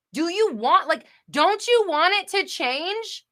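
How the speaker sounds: loud, high, shouted tough-love rant; screaming rant. Real, not acted. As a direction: yell it at them to shake them awake. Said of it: "That's why I was screaming".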